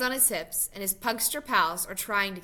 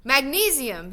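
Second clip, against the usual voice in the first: higher, louder call over fireworks; excited shout; 4 semitones higher, 5 dB louder than usual. A narrator's reading. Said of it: "he shouts".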